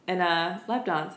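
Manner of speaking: usual speaking voice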